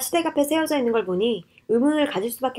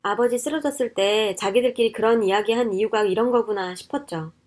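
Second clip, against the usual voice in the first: usual speaking voice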